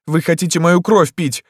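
high, loud question; alarmed girl. A narrator's reading. Girl challenges emperor.

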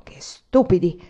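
mild muttered scorn; grumbled. A narrator's reading. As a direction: grumble it under your breath. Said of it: "e borbottò".

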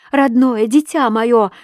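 high, loud, drawn-out endearment; imploring. A narrator's reading. Wife implores her dear child, imploring cry. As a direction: plead with aching insistence.

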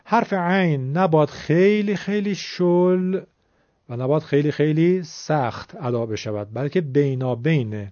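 ordinary delivery

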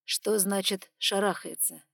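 slow, even question; calm and measured. Acted. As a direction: ask it calmly, deliberately. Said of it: "произнёс спокойно и размеренно".

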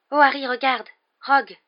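high, loud alarmed cry; urgent exclamation. Acted. Hermione alerting Harry across the lake, loud and alarmed. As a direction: call out sharply, pointing in alarm.

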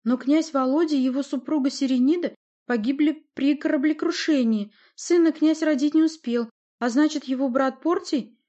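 high, loud objection; protesting argument. A narrator's reading. Boyar argues succession with the sorceress, protesting. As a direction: argue it up to a question.

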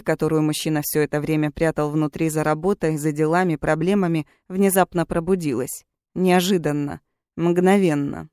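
ordinary delivery, nothing marked

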